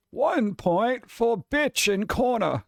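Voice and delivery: solemn wizard headmaster, drawn out; Dumbledore voice. Acted. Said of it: "say it in a Dumbledore voice".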